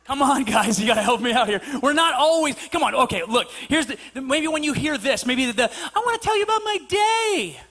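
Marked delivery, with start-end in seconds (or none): 5.7-7.6 high-pitched singsong wife voice; mock chattering wife. Wife telling husband about her day, mock high singsong. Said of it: "she's talking and talking about her day".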